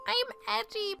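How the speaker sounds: mock child's squeak, high and soft; high-pitched kid voice. Player mimics the kids on here, high-pitched mock voice. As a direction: imitate a kid in a strained high voice. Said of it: "I can't do my high-pitched voice".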